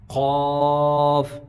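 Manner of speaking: slow, heavy, sustained قاف; back-of-throat قاف. Shown as a demonstration. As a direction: strike قاف at the back and hold it. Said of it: "وہاں سے ہی ادا ہوگا".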